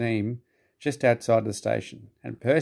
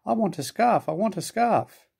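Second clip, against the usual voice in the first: whining Percy; 9 semitones higher than usual. A narrator's reading. Percy whines for a scarf.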